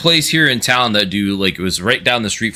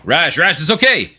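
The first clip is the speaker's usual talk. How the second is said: mock reassuring; 8 semitones higher than usual. Consoling a character mockingly.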